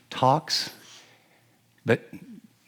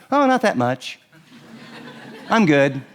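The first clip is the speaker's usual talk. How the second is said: dismissive brush-off. Shown as a demonstration. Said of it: "here's the non-option".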